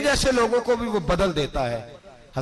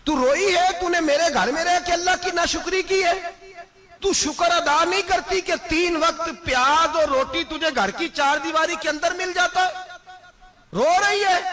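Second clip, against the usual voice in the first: stern rebuke; 12 semitones higher than usual. Retold or imitated. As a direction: rebuke her sharply for her ingratitude. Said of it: "हजरत उमर जलाल में आ गए".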